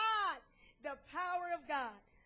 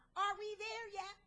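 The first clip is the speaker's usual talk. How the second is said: put-on higher, nagging child's questions; pestering little boy; 4 semitones higher than usual. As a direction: imitate a small boy pestering his mother. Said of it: "He kept asking his mother".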